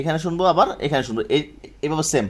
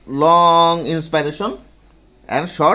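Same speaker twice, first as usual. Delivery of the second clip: slow and louder, each word hammered; slow emphatic recap; 6 dB louder, much slower than usual. Shown as a demonstration. Teacher recapping the rule for students, slow and loud. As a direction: drum the rule into the class. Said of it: "vesicular সাউন্ডটা কি দাঁড়ালো?".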